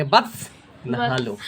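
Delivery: put-on soft, low and slow, like coaxing a child; lovingly. Teacher voicing a parent coaxing a dirty child to bathe, tender and slow. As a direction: tenderly coax the little one to bathe. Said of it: "इसको प्यार से कहेंगे".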